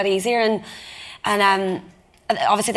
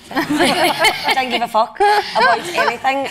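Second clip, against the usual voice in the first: laughing; 5 semitones higher, much slower than usual. Real, not acted. Laughs after asking to swear.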